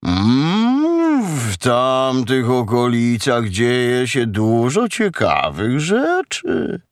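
slow, drawn-out cow voice; murmuring cow. A narrator's reading. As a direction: draw every vowel out like a lazy moo. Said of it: "odmruknęła krowa".

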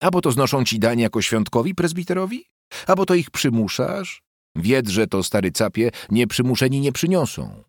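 sarcastic wife, high questioning voice; indignant wife. A narrator's reading. Wife berating husband about church dues, indignant. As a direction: challenge him with high-pitched sarcasm.

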